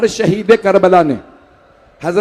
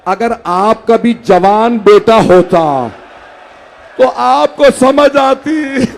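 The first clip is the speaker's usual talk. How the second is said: very slow, rising to a loud sob-laden cry; father crying out to his son, slow and loud; weeping father; 3 semitones higher, 5 dB louder, much slower than usual. Acted. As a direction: drag each word out through tears. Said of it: "मौला हुसैन ने रो के कहा".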